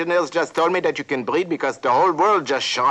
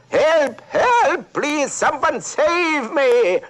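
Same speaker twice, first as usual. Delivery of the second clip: yelled for help; 13 semitones higher, much slower than usual. Acted. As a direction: scream for help, drawing out the last words slowly. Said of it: "and she yelled".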